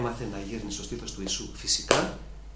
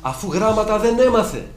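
much higher, louder amazed question; mock astonished contemporaries; 10 semitones higher, 11 dB louder than usual. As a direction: exclaim in disbelief. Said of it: "Απορούσαν οι σύγχρονοι του".

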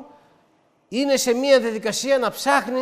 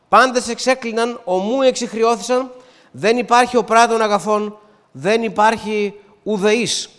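raised, declaimed Bible verse; emphatic scripture reading; 4 dB louder than usual. Real, not acted. Preacher reading Romans to the congregation as proof, emphatic declamation. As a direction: proclaim each clause firmly, a little louder. Reads Romans chapter three aloud.

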